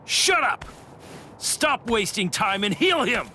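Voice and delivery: high, impatient yell; angry order. Acted. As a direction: bark the command to cut her off. Orders girl to heal.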